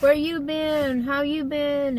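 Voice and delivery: high, slow, excited 'where you been'; playful mock coworker excitement; excited coworkers greeting. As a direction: mimic coworkers thrilled to see you again. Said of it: "They were like".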